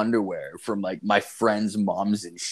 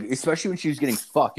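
usual speaking voice

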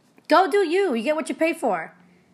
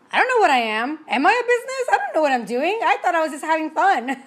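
bewildered rambling; 4 semitones higher than usual. Retold or imitated. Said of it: "I remember telling somebody".

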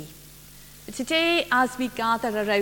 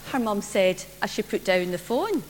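ordinary delivery

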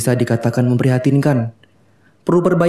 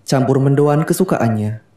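ordinary delivery, nothing marked

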